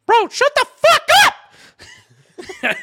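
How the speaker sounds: very high, loud, drawn-out yell; mock furious scream. Imitating Jake snapping at a screaming teammate, furious yell. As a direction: scream it like a friend finally snapping.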